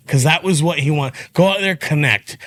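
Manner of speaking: normal talking voice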